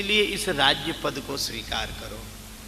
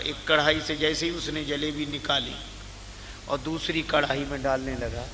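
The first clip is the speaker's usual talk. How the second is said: usual speaking voice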